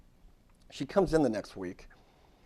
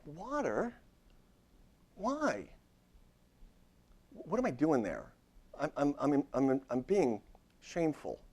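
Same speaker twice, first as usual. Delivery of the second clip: mock surprise; 6 semitones higher, slower than usual. Therapist mimics his shocked reaction.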